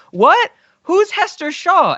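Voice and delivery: high, loud shocked gasp of a question; a daughter startled by a name she doesn't know. Retold or imitated. Daughter Catherine blurting a question at her father, high and shocked. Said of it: "And she goes".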